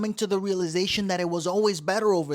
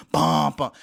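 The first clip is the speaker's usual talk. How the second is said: bass thumps; 6 dB louder than usual. Retold or imitated. Imitating car subwoofer.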